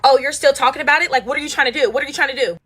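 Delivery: high, loud mock challenge; confrontational imitation. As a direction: imitate someone pressing for a fight. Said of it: "the way she's pressing me".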